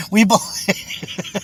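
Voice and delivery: slow words broken by laughter; laughing. Real, not acted. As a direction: chuckle through "we believe". Laughing at own claim.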